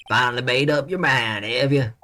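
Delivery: put-on lightly Southern old man; Southern accent. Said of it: "He's so southern".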